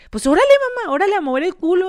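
loud, high, fast urging; bossy hustling. Retold or imitated. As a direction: imitate a boss hurrying someone onstage. Club boss hustling her along.

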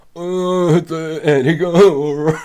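high, loud whiny mimicry of him; exaggerated mocking impression of her partner; mocking imitation voice. Partner imitating him in a mocking, loud, high voice. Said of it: "egen hermeterstemme".

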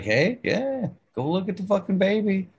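high-pitched, quick cat voice; voiced cat. Cat telling parents about the baby, high put-on voice. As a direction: speak for a nagging cat in a high voice.

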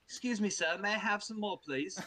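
high, pleading mock British orphan; Oliver Twist accent. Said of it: "like Oliver, that kid from the".

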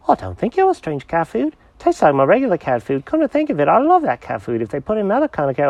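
high, eager cat character; excited cat voice. Acted. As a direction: bounce it out like an excited kitty. Jenkins voice.

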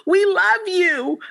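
put-on high pleading child voice, slowing on the end; begging kids. Students begging their teacher not to leave, high pleading. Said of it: "they started saying".

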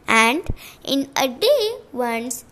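usual speaking voice

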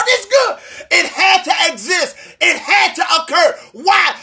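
preacher building to a peak; full-force preaching. Real, not acted. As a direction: drive it home to the congregation.

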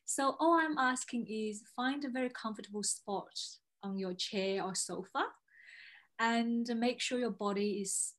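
slow, soothing meditation guide; guided meditation. Acted. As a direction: ease them into calm breathing. Leads a grounding exercise.

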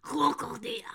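Krokodil whispered without voice. Acted. Co-host whispers the German word back.

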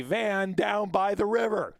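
Chris Farley. Retold or imitated. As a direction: ham up the van down by the river line. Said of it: "Chris Farley, uh, reference there".

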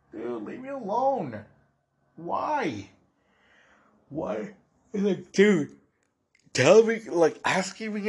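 high-pitched exasperated whine; exasperated pleading. Retold or imitated. Speaker to friends who keep asking if he's mad, exasperated pleading. Fed up with repeated mad questions.

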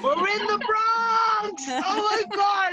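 slow, high mock shout, half laughing; hammed-up yell about being in the Bronx.

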